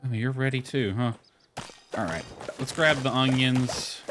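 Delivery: ordinary talk, nothing marked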